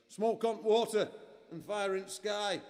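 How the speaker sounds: put-on sung-out lyric in a broad Yorkshire accent; Sean Bean Yorkshire. Performer mimicking what he hears in his head, Yorkshire singing. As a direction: belt it lightly like a Yorkshireman, drawn out. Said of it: "gets a bit Sean Bean for me".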